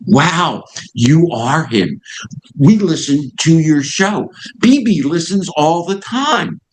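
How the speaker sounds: pitched well up, slow and amazed, a retold manager's wow; excited realization. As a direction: let it dawn on him with a high, drawn-out wonder.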